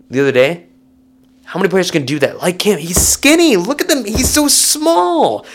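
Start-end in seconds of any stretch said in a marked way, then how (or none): 2.8-5.4 much higher, "so small" drawn out slowly; mock cooing. Real, not acted. Praising Ferg's size to listeners, high mock cooing. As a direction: coo over him like a tiny pet. Gushing over a small defender.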